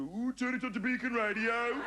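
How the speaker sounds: high Brummie lilt coming out; Birmingham accent. Retold or imitated. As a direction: let the Birmingham accent creep in. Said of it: "My voice starts to go".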